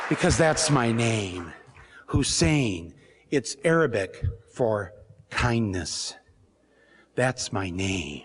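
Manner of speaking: low, slow, deliberate voice; Obama's calm reply. Retold or imitated. Obama explaining his name, low slow proud calm. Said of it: "And he said".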